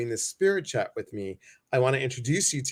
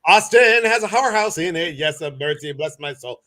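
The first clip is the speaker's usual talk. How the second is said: mock scandalized; 2 semitones higher, 7 dB louder than usual.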